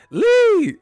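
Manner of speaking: shrieking high yell of Lee; Chris Tucker yell. Retold or imitated. Chris Tucker shrieking for Lee, high-pitched and loud. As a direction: scream out Lee. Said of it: "That's how Eddie Hearn".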